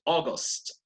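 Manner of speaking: August as a model "o-gost". Shown as a demonstration. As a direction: say August starting with O. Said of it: "Let's repeat it".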